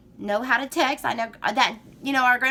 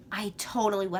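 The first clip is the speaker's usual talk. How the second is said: ordinary talk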